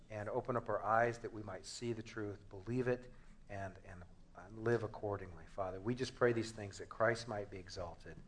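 soft, low prayer voice; hushed closing prayer before a sermon. Real, not acted. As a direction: pray quietly and reverently. Leads a prayer.

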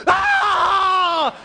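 high, long shouted "aah"; imitated crowd roar. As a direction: yell like a stadium full of fans. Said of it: "seventy thousand people going".

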